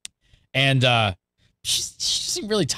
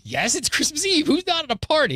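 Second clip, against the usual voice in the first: incredulous; 13 semitones higher than usual. Retold or imitated. Retelling his answer to the vet.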